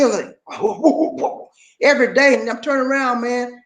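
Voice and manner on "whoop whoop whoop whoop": mock whooping cough; imitated breathless coughing. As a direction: whoop as if struggling for air. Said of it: "couldn't hardly breathe".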